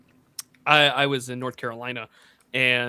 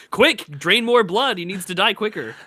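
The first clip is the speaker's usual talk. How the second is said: mock urgent nurse; 6 semitones higher, 7 dB louder than usual. Nurse in a Canadian hospital, mock urgent orders. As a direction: bark it like an emergency order.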